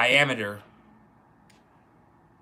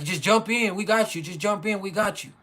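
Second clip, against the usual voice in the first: higher, coaxing voice urging him to jump; coaxing call; 6 semitones higher than usual. Retold or imitated. Boys in the water urging Jeremy to jump in, high coaxing call. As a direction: talk him into jumping, pitched up and eager. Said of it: "And they're like".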